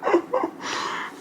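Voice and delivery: a high breathy laugh through emotion; half laugh, half tears; teary laugh. Real, not acted. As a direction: laugh it off while welling up. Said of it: "I'm an emotional mess".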